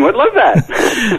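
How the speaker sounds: laughing through the words, high and slow. Real, not acted. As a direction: giggle it out, way up high. Laughing at the joke.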